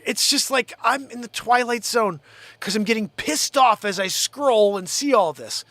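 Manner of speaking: ordinary talk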